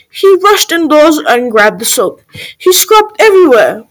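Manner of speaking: ordinary delivery, nothing marked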